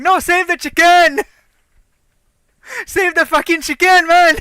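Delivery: high, loud yell of the meme line; mock yell quote. Teammate leaving a chicken in Scum, a high loud mock yell. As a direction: shout it like the supervisor. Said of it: "I'm gonna be like".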